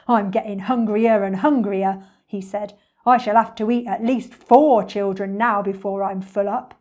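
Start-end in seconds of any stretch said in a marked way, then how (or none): 0.1-2.0 lightly voiced grumbling crocodile, louder; a hint of greedy beast; hungry crocodile. 3.1-6.7 lightly voiced grumbling crocodile, louder; a hint of greedy beast; hungry crocodile.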